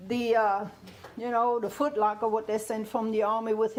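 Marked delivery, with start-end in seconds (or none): none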